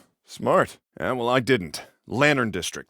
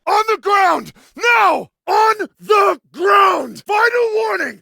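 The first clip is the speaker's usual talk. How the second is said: full-force high yell, words punched out; shouted command; 8 dB louder, slower than usual. Acted. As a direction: order a suspect down at full volume. Armed order to surrender.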